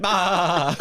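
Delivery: parrot laugh. Retold or imitated. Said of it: "the rest of the parrots laughed".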